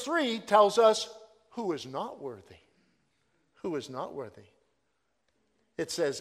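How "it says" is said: ordinary delivery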